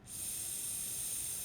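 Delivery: "S" hissed long and voiceless; teacher sustaining "S" for the class; hissed sound. Shown as a demonstration. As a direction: hold a long hiss for "S". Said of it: "Next sound".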